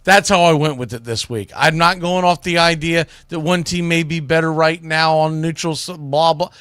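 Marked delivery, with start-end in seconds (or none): none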